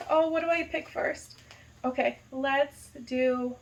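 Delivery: ordinary talk, nothing marked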